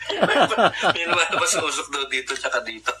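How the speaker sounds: words through real laughter; laughing. Real, not acted. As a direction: laugh while telling it. Laughing while retelling friend's line.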